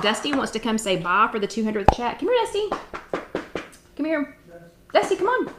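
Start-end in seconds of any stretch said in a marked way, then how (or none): 2.2-4.3 much higher sing-song call to a pet; calling the dog. Real, not acted. Calling her dog over. 4.9-5.5 much higher sing-song call to a pet; calling the dog. Real, not acted. Calling her dog over.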